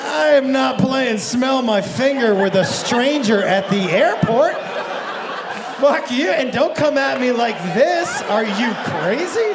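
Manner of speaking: loud, emphatic mock outrage; hammed-up indignant shouting, words stretched; indignant refusal. Comic refusing a stranger's smell-my-finger request, indignantly.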